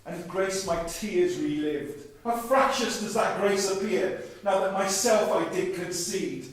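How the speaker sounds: slow, measured spoken-word delivery; poem recital. Acted. Poet reciting a reworked Amazing Grace, measured spoken word. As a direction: recite it like a bitter hymn.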